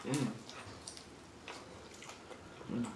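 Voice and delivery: normal talking voice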